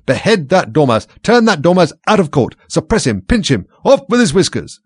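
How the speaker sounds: high, loud string of furious orders; shrieking. A narrator's reading. Queen ordering the dormouse punished, high loud shrieking. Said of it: "the Queen shrieked out".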